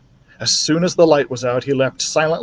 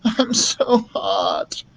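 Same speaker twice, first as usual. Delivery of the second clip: feverish mumble; 11 semitones higher, much slower than usual. A narrator's reading. Sick boy in bed complaining to his mother, feverish mumble. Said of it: "mumbled Keith".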